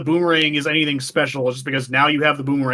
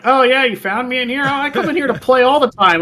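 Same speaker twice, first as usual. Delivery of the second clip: high mock princess voice, louder; mocking Ruto; 10 semitones higher, 5 dB louder than usual. Mocking the spoiled fish princess, high mock girl voice. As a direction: mimic a spoiled girl, higher. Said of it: "she's like".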